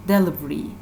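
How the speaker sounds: usual speaking voice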